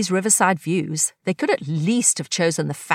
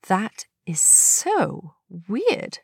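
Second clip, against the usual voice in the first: slow, wondering friend's voice; puzzled Athena; much slower than usual. Acted. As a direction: let the weirdness sink in slowly.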